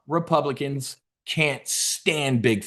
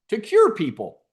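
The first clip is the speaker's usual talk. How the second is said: high, eager mock answer; naive answer; 8 semitones higher, 3 dB louder than usual. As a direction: pipe up like a hopeful pupil. Acting out a naive audience answer.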